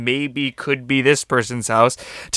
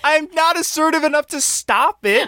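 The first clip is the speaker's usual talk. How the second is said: pleading, pitched way up; high panicked whine. Acted. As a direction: whine helplessly in defence. Rich pleading, high and strained.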